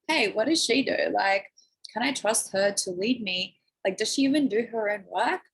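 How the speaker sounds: normal talking voice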